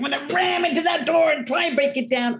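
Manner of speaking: high, fast burst of determination; full blast. Acted. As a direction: charge through the words with renewed force. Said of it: "We go full blast".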